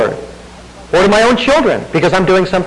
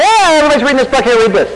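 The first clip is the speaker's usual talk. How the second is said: higher, fast, breezy careless parent; a mock thoughtless father brushing it off, higher and quick; careless dad; 7 semitones higher, faster than usual. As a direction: toss it off without a thought. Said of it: "threw Harry Potter at my kids and said".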